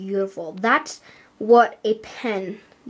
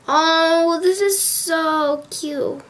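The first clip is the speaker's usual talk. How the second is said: high, drawn-out gushing coo; 6 semitones higher, much slower than usual. Real, not acted. Vlogger sees something cute from fans, cooing. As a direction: coo slowly in a high, touched voice. Gushing over a fan's message.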